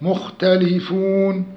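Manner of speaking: مختلفون with a short long-u ending; recited مختلفون, final vowel least stretched; short madd. Shown as a demonstration. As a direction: give the oo in مختلفون a brief hold. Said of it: "مد عرض للسكون، اتنين، أربعة".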